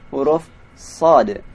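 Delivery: usual speaking voice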